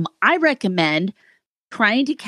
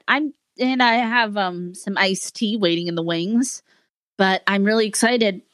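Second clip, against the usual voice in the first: normal talking voice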